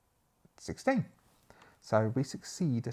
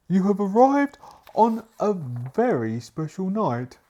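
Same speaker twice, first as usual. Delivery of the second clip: loud, higher, slow and grand; butler's voice; 9 semitones higher, 11 dB louder, much slower than usual. Acted. Butler welcomes guests to a special night, slow grand delivery. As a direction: announce it grandly, word by word. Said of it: "he explains".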